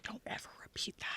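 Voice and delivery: hushed secret warning; whisper. Retold or imitated. Uncle to the little niece on the pipe, whispered warning. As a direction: whisper it so mom won't hear. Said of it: "And he's like".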